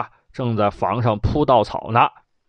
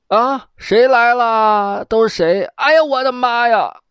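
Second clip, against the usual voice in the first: high, loud startled cry, slowing on the question; old scholar shocked to see the emperor; startled old man; 10 semitones higher, 4 dB louder than usual. Acted. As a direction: jump in fright at the sight.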